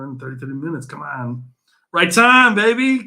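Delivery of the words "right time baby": higher, louder hype call to the chat; excited hype. Real, not acted. Hyping up the livestream chat, excited and loud. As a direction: pump up the viewers, hyped.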